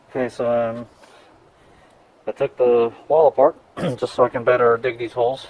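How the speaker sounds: speaking through a dust mask; words dulled behind a mask; muffled by dust mask. Real, not acted. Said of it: "if I sound muffled, it's 'cause I'm wearing a dust mask".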